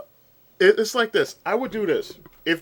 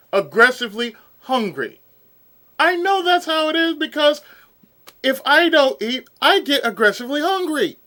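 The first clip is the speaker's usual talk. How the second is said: heated rant; 7 semitones higher, 7 dB louder than usual. Real, not acted. Heated rant about silly study.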